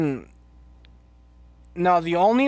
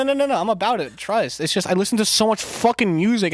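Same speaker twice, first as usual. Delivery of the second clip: ordinary delivery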